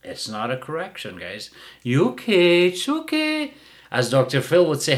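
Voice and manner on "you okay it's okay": put-on high baby-talk coo; mock owner's high comforting coo. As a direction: soothe the dog in a high sing-song. Mocking the owner again.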